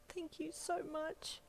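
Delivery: crying softly into her husband's arms; sobbing. Acted. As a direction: thank him through sobs. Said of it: "you sobbed".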